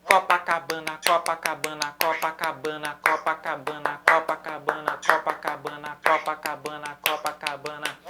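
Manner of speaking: "Copacabana" chanted in five even syllables, low monotone loop; cinque note uguali. Shown as a demonstration. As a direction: fit "Copacabana" evenly into each beat, accent on the first syllable. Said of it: "Agora eu vou fazer isso".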